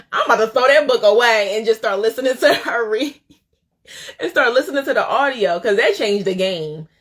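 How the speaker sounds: loud, high, laughing with real excitement; excited outburst. Real, not acted. Host learns favorite author reads the audiobook, excited outburst. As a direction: blurt it out, thrilled by the discovery.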